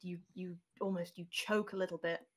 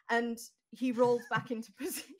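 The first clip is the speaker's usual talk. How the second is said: usual speaking voice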